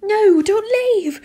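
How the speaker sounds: loud, high, drawn-out wail; pleading. A narrator's reading. Student begs teacher to stay, loud desperate plea. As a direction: cry it out desperately, stretching each word. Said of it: "I pleaded".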